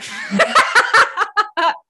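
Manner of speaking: high, loud cackling laugh. Real, not acted. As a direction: let the laugh burst out high. Laughing at own aside.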